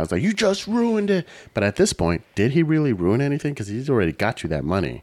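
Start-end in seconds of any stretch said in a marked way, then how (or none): 0.2-1.3 high, drawn-out mock wail; hammed-up high-pitched freak-out. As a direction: stretch it out in exaggerated outrage. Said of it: "Derek's freaking out".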